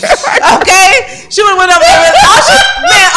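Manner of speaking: ordinary talk, nothing marked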